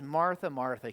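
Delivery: low, gentle address by name; tender. Acted. Jesus answering an upset Martha, tenderly. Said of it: "he very tenderly says".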